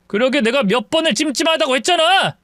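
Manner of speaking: ordinary delivery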